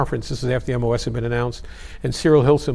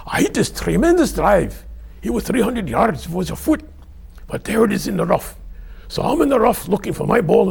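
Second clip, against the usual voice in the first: high, lilting Scottish storyteller; Scottish accent; 6 semitones higher than usual. Acted. [name] telling the office his golf story, Scottish accent. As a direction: spin the yarn high in a put-on Scottish accent. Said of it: "my Scottish accent".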